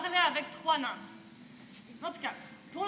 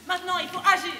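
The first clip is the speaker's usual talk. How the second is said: firm command; 3 semitones higher, 10 dB louder than usual. Retold or imitated. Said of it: "il a dit".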